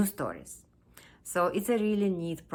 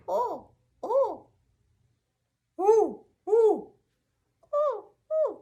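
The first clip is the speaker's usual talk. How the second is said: very high paired hoots; high, loud imitation owl calls; puppy copying owl; 9 dB louder, slower than usual. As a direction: echo an owl's hoot in a high puppy voice. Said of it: "and he said".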